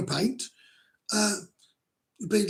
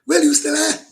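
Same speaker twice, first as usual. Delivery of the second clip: higher and louder, calling to check someone is there; 9 semitones higher, 9 dB louder than usual. Real, not acted. Streamer calling to a silent viewer, loud and raised. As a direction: call out to see if anyone's still on the line.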